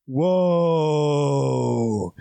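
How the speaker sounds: long, drawn-out low whoa; awed crowd. Retold or imitated. Imitating a movie audience around him, awestruck. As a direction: let it trail out in hushed amazement.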